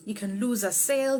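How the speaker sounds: ordinary delivery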